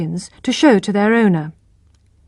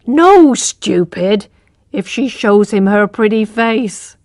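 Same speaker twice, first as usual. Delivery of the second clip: scornful wife; slower than usual. Acted. Mother scolds husband.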